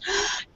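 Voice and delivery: high, soft adoring gasp; melting gasp. Acted. Kay sees the patchwork kitten, melting gasp. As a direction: gasp as if melting over something tiny. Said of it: "melts to this little mush".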